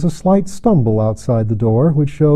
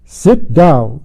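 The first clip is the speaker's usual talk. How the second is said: a louder commanding order; 2 semitones higher, 6 dB louder than usual. A narrator's reading. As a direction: command the room to sit. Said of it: "a voice that made men drop drawn swords".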